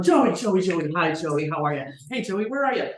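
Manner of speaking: loud, eager hailing; urgent calling out. Retold or imitated. Leaping over the desk to reach the missing brother, urgent. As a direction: act out pouncing on the phone, loudly calling him.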